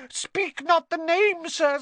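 very high, slow, squeaky wail; moaning elf plea. A narrator's reading. Dobby begging Harry not to name him, high moan. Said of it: "Dobby clapped his hands ... and moaned".

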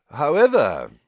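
loud, deliberate announcement; slow and clear. A narrator's reading. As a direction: draw the word out for all to hear. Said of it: "speaking very slowly and clearly".

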